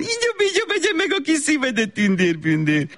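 squeaky-high gush sliding down; cooing baby talk. Acted. Mother sending child off to play, gushing baby talk. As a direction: coo silly endearments in a very high voice. Mother coos nonsense endearments.

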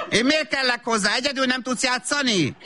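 high, exasperated complaint; irritated nagging. Acted. Mother busy on her phone, exasperated with child. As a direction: fend off a child with high-pitched annoyance.

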